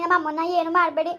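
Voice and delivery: ordinary talk, nothing marked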